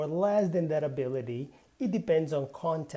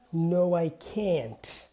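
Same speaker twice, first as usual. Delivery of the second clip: slow answer ending on a clear "can't" with its T; stressed can't; much slower than usual. Shown as a demonstration. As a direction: stress "can't" and sound the final T. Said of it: "especially with the T".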